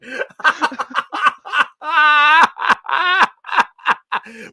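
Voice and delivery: high wheezing laugh, near tears; helpless crying laughter; laughing fit. Real, not acted.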